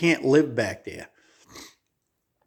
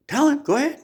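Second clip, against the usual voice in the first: put-on much higher little old lady, insistent; old lady urging; 13 semitones higher, faster than usual. Old woman prodding her friend to tell, high-pitched and eager. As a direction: push him to listen, high old woman voice. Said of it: "the woman said".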